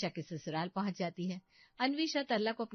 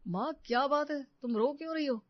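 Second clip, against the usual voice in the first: higher, louder worried son; alarmed concern; 6 semitones higher, 4 dB louder than usual. Acted. Son asks his crying mother what happened, alarmed concern. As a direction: ask with urgent concern.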